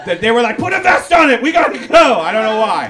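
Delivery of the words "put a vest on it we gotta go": high, loud panicked cop yell; mock police alarm, shouted high and loud; frantic shout. Cops panicking over the dog.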